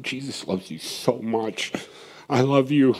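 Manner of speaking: almost hushed, holding her arm; soft tender repeat. Retold or imitated. Holding the girl's arm a second time, hushed tender assurance.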